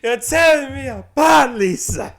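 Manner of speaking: high, loud, slow anguished yell; hammed-up anguished shout. A hammed-up shout quoting The Room to a friend. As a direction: overact the famous line at full tilt.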